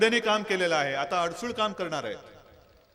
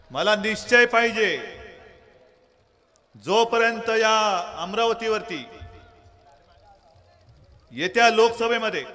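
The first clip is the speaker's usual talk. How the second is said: loud rally oration; 5 dB louder than usual. Real, not acted. Rousing crowd at rally.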